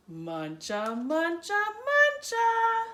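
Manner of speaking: building munch. A narrator's reading. Munching crescendo.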